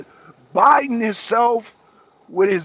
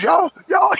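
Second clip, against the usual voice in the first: excited call; 11 semitones higher, 4 dB louder than usual. Real, not acted. Calling out viewers excitedly.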